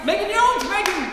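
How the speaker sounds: loud, high name call. Real, not acted. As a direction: shout the name back. Repeats a shouted name.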